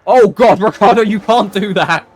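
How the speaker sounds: loud shocked protest; excited outcry. Real, not acted. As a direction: cry out at the foul. Commentator reacts to dirty move.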